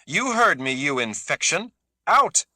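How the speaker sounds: high, loud furious scream. A narrator's reading. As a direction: tear into him at full voice. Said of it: "Kazi screamed".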